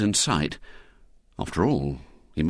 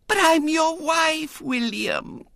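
put-on very high, slow wheedling little girl; wounded small-girl appeal; Violet Elizabeth; slower than usual. A narrator's reading. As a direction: protest in a piping lisp. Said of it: "said Violet Elizabeth".

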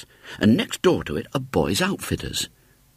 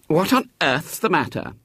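higher, indignant demand; 9 semitones higher than usual. A narrator's reading. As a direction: sound put out at the fuss. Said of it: "he said indignantly".

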